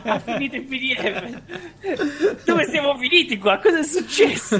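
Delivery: high, laughing incredulity; laughing disbelief. Real, not acted. Disbelief at out-of-stock PDF.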